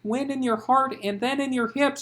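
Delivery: loud, high urgent coaching; spurted urging. Acted. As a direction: spur her on hard. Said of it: "she spurted".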